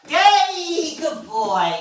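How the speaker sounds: loud cheer starting high, sliding down; delighted whoop into hearty praise; excited praise. Real, not acted. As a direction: celebrate with the dog. Praising the dog.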